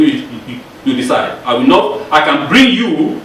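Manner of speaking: normal talking voice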